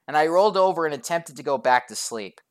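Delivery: ordinary delivery, nothing marked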